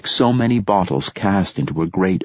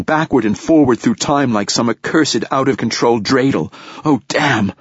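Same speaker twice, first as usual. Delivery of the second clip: higher-pitched, mounting exasperation; frustrated outburst; 6 semitones higher than usual. A narrator's reading. Rachel rails at Ania about their lives, frustrated. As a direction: pour out the grievance without a break.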